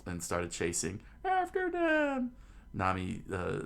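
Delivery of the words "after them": drawn-out, very high falsetto yell; mob's chase cry. Acted. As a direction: send the mob after them, squealing. Townspeople start the chase.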